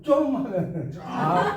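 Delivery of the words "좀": 좀 snapped short, high and loud in Gyeongsang style; Gyeongsang curt 좀. Retold or imitated. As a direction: bark 좀 as a one-word Gyeongsang command. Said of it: "근데 경상도 말은". (경상도 사투리로 짧고 세게 내뱉는 좀)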